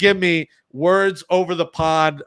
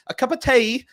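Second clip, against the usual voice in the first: high-pitched mock London accent; hammed-up London accent sliding off target; bad London accent; 9 semitones higher, slower than usual. Shown as a demonstration. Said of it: "I tried it in the open here".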